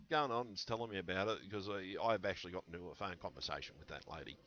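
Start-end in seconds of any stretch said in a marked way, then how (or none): none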